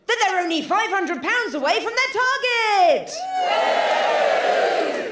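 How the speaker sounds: outraged exposé. Acted. Exposing the cons to crowd.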